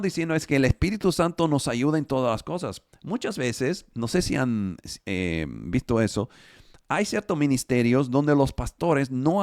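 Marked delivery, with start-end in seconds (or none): none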